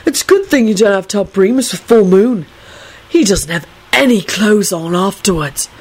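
mildly dramatic confiding; a touch of stoned drama; dramatic hiss. A narrator's reading. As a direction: confide it as a scandal. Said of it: "Neville hissed dramatically".

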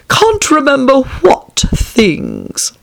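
high, slow, stretched caterpillar question; languid caterpillar. A narrator's reading. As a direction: draw out every word as the lofty caterpillar.

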